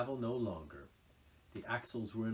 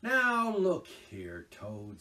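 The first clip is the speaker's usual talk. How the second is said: slow, high, loud reprimand; sharp; 8 semitones higher, 11 dB louder, much slower than usual. A narrator's reading. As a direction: call him to order sharply. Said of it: "said the rat sharply".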